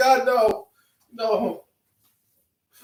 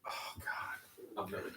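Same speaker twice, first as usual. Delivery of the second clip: breathless whispered dread; barely voiced, dreading it; horrified whisper; 23 dB quieter, slower than usual. Real, not acted. Dreading the waxing.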